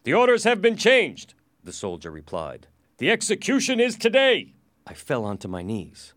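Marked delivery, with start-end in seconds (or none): 0.0-1.3 loud, high, forceful reply; soldier's announcement. Acted. Soldier answers prisoner. 3.0-4.5 loud, high, emphatic correction; soldier's announcement. Acted. Soldier overrules prisoner.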